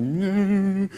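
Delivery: choked crying word. Retold or imitated. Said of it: "when you're crying and you try and speak".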